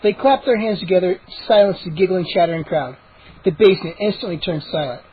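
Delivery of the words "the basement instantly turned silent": usual speaking voice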